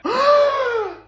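a very high, loud gasp; starstruck gasp. Acted. Teacher meeting her idol's daughter, gasping in awe. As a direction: gasp like a shrieking fan. Teacher realizes who the pupil is.

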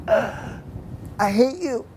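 very slow, winded gasp of a mock complaint; breathless. Thrown rider to laughing friends, breathless and winded. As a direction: wheeze it out after being thrown off the steer. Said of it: "out of my breathless mouth".

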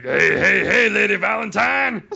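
high, loud cartoonish Fat Albert voice; Fat Albert impression. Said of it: "this Fat Albert impression".

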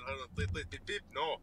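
normal talking voice

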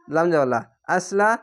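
ordinary talk

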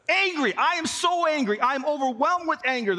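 high, emphatic retold outrage; mock angry. Boy overwhelmed with anger after being shot, mock angry. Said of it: "I am angry".

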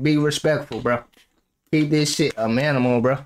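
low, restrained dad talk; low-pitched, understated warning; low fatherly advice. Pretend. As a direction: lay down the rule in a low voice. Voices his advice to the daughter.

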